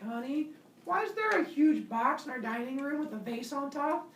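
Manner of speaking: high, puzzled wife's voice. Acted. Pandora voiced asking husband.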